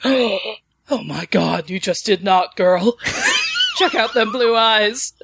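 lightly voiced sassy Felix, slow at the start, with a laughing shout; a hint of Felix gushing over the Fae; Felix impression. Felix reacting to Bo, lightly voiced sassy gushing. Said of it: "I can just imagine him going".

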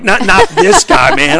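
high, loud, slow mock protest with a laugh; hammed-up disbelief, cracking up at the end; exaggerated cry of not this guy, laughing; mock dismay. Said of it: "I'm like".

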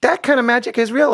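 high, unsure fatherly fib; stalling dad. Retold or imitated. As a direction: fudge it to save Santa. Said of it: "how do I spin this".

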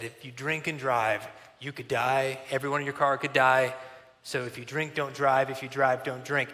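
low, soft, grave ad voice; scary commercial. Retold or imitated. Quoting a scary TV ad about drunk driving, low and grave. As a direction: quote it like a sober warning ad. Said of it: "it was a scary commercial".

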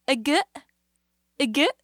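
high mock hiccup sounds; hiccup imitation. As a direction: imitate a kid's hiccup, teasing. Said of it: "mimicking the sound of my hiccup".

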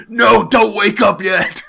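mock villain plea. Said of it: "He's just like".

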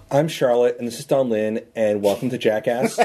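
deep goofy stunt-show host intro; Jackass intro voice. Pretend. Mock Jackass intro headcanon.

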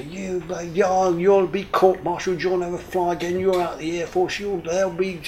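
blustering threats. Retold or imitated. Commander threatens court-martial.

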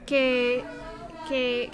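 ordinary talk

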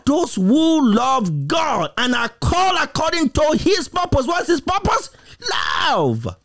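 high-pitched, urgent preacher's challenge; impassioned preaching. Real, not acted. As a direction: press the congregation with rapid-fire rhetorical questions. Preaches with rising intensity.

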